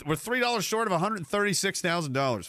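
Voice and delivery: normal talking voice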